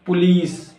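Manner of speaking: "police" as puh-LEECE; corrected POLICE. Shown as a demonstration. Teacher correcting learners, "police" said as puh-LEECE. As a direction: say "police" with a long EE. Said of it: "it's not police".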